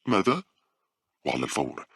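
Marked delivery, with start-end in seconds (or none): 0.0-0.4 much higher, louder startled ماذا؟; alarmed high cry of ماذا؟; panicked question. A narrator's reading. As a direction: jolt out ماذا؟ in sudden fright. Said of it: "تسأل في ذعر".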